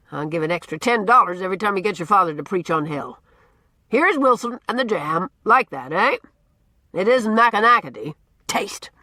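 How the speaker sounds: put-on loud, gruff, teasing old man holding forth; blustering old Norman. A narrator's reading. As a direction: boom it out like a cranky, likeable bully. Norman continues.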